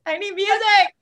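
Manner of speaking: high laughing call for music. Real, not acted. Host laughing, asking for music, high and giddy. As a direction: laugh through the request, high.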